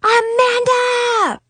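high, slow, drawn-out yell of the name; long shout. A narrator's reading. Big sister calling angrily up to her sister in the tower, long shout. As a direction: bellow it across the yard. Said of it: "I screamed as I rushed out of the store".

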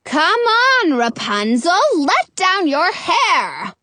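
high, drawn-out holler; impatient, high-pitched call from below. A narrator's reading. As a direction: call up to the window, stretching the last words. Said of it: "Frau Gothel hollers".

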